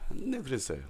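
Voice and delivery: ordinary talk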